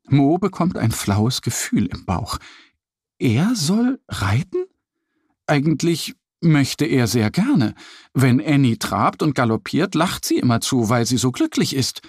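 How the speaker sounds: ordinary delivery, nothing marked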